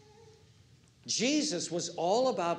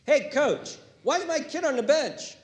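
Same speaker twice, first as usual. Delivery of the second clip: high, loud indignant parent calling to coach; mock a parent demanding playing time; hammed-up complaining sports dad; pushy parent; 10 semitones higher, 5 dB louder than usual.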